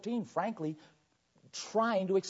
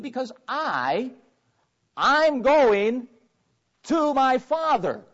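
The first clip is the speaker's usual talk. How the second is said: slow, swelling preached quote that climbs to a loud high peak; preacher thundering the words of Jesus; fiery scripture reading; 4 semitones higher, 7 dB louder, slower than usual. Acted. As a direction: bear down and build it to a cry on going to my Father. Preaching scripture at full force.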